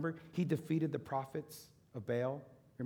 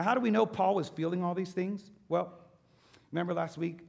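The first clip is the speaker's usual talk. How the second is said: ordinary talk, nothing marked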